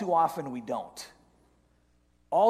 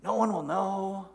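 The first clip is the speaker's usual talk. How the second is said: soft, coaxing tempter's voice; devil on the shoulder; 4 dB quieter, slower than usual. Acted. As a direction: coax quietly, slowing at the end. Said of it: "the second voice jumps in and says".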